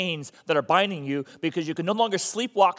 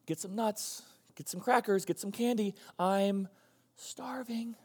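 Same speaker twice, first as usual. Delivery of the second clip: soft, high pleading stomach, fading to a whisper; faint starving whimper of a hungry belly; crying-out stomach; 4 semitones higher, 7 dB quieter, slower than usual. Acted. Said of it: "your stomach ... is crying out".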